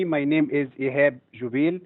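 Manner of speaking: usual speaking voice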